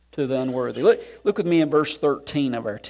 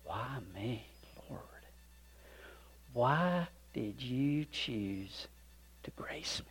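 hushed, slow, humble whisper; awed whisper; 4 semitones lower, 17 dB quieter, slower than usual. Pretend. Believer before God, whispering in humble awe. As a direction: breathe it to God in wonder.

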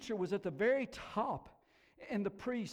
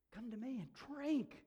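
softer, gentler call; softened invitation; 5 semitones higher, 6 dB quieter than usual. Acted. Preacher repeating Jesus's invitation to the thirsty, softened. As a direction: bring the shout down to a quiet appeal.

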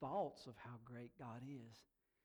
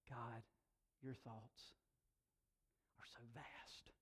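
soft, lower, awed address; reverent prayer; 4 semitones lower, 11 dB quieter, slower than usual. Retold or imitated. Psalmist speaking to God about his thoughts, quiet reverence. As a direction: pray it quietly with pauses.

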